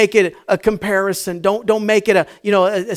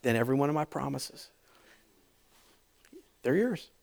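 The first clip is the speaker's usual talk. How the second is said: gentle God voice; 6 semitones lower, 12 dB quieter than usual. Acted. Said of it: "It says".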